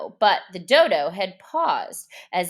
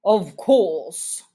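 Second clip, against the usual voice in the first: grave reply; 6 dB louder than usual. A narrator's reading. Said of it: "replied very gravely".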